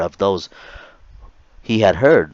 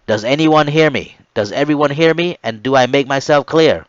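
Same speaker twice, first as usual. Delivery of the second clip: loud, raised commanding voice; stern announcement; 4 semitones higher, 4 dB louder than usual. Retold or imitated. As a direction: lay down the law to the recruits.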